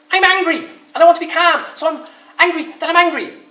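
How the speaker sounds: high, loud, frustrated rabbit voice; angry Rabbit. Acted. Rabbit telling Bear he is angry at himself, loud and high. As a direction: spit it out in high furious frustration. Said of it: "Rabbit kicked himself".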